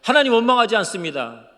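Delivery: loud, high declaration; impassioned avowal at full voice; declaring to God. Retold or imitated. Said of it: "그래서 하나님께 드렸어요".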